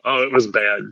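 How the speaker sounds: ordinary talk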